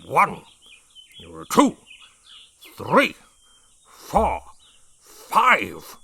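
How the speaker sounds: slow, loud count with pauses. A narrator's reading. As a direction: count it out like an order. Brig counting loudly.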